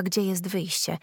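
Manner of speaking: ordinary delivery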